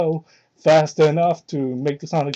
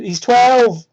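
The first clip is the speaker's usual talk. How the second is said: louder sudden exclamation of disbelief; outraged outburst; 4 semitones higher, 6 dB louder than usual. Real, not acted. Reader breaks off the story in disgust, loud outburst. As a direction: blurt it out, appalled. Outraged interruption while reading.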